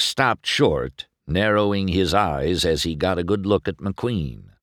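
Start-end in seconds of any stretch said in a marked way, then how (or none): none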